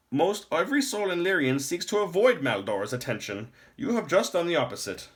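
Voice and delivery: put-on high, loud old man's voice; a grave old lore master scolding; stern old man.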